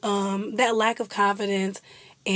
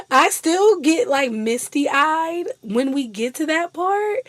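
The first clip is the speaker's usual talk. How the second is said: higher and louder, then slowing; emotional gush; 6 semitones higher, 3 dB louder than usual. Real, not acted. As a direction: let it catch you. Emotional about favorite scene.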